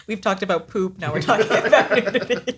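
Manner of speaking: giggling through the words; laughing. Real, not acted. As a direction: laugh as you say it. Giggling about awkward topics.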